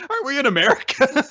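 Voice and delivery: squeaky puzzled question; high mock confusion. Joking about country names in a high confused voice. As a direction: play a dim character in a high voice.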